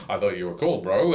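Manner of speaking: low, disappointed bro voice; hurt alpaca. Retold or imitated. Alpaca leaving the storyteller, low and hurt. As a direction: let him down, low and hurt. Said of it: "And he's like".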